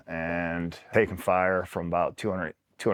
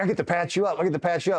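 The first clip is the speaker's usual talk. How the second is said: high-pitched imitated delight; gleeful buddy; 9 semitones higher, faster than usual. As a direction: crow it, thrilled to get the job. Gleeful buddy eager to treat him.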